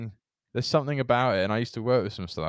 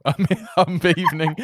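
higher, louder, breaking into laughter; laughing; 6 semitones higher, 5 dB louder than usual. Real, not acted. Correcting a slip to the co-host, laughing. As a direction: say it while cracking up.

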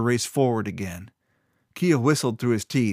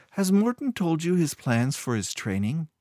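casual question, pitched higher; conversational; 6 semitones higher than usual. A narrator's reading. Kayleen asking Kyo about training plans, conversationally. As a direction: make small talk, lightly voiced and higher. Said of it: "Kayleen asked conversationally".

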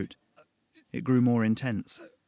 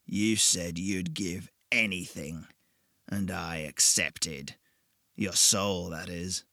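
slow, lower, menacing purr; old devil collecting a debt, smug; the devil, sly and patient; 3 semitones lower, much slower than usual. Acted. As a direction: savour every word like a bargain won. Devil claims the soul.

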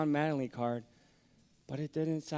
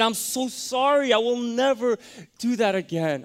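much higher and louder, pleading through tears; tearful apology; 11 semitones higher, 9 dB louder than usual. Retold or imitated. Son apologizing to his mother, loud high tearful pleading. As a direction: plead sorry as if crying. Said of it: "And so I started crying".

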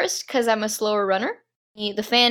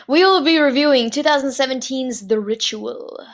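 formal podcast announcer read; announcer intro; 4 semitones higher than usual. Acted. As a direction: open the show like an announcer. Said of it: "clears throat".

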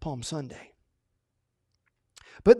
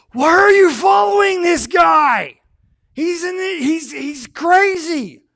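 high, loud yelling Pharisee; angry shout; 11 semitones higher, 9 dB louder, slower than usual. Acted. Pharisees yelling at the passing followers, furious and high. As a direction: shout them down in outrage.

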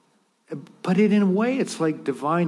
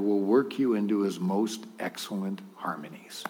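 hushed; 9 semitones lower, 8 dB quieter than usual. Real, not acted. Quoting God's promise.

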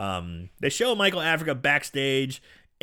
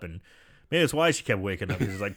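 normal talking voice

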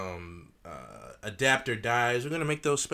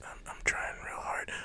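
whisper; 11 dB quieter than usual. Real, not acted. Whispered aside.

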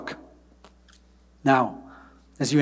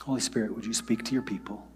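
soft, quiet prayer voice; hushed pastoral prayer; closing prayer; 2 semitones lower, 7 dB quieter than usual. Real, not acted. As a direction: pray gently over the people. Leads congregation in prayer.

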